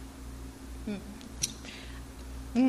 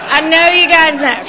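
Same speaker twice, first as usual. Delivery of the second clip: real giggles, loud and higher; laughing; 5 semitones higher, 13 dB louder than usual. Real, not acted. Preacher sharing a hot flash joke with the ladies, laughing. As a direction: talk loudly through real laughter.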